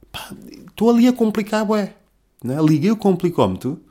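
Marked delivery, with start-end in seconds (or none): 0.1-1.9 pitched-up self-mocking complaint; exasperated. Real, not acted. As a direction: groan at your own overthinking. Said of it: "self-exasperated".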